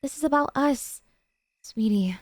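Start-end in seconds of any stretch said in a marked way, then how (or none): 1.6-2.2 breathy whispered pet name. Acted. Stalker whispering to her beloved, whispering. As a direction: whisper it right into the ear. Yandere leans in close.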